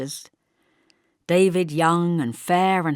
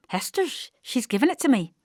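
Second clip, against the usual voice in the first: put-on proud little girl; 7 semitones higher than usual.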